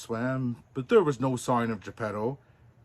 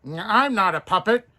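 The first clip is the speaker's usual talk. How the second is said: high, loud retort; a cheeky shout; 9 semitones higher, 8 dB louder than usual. Acted. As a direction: shout it back defiantly. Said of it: "shouted the puppet".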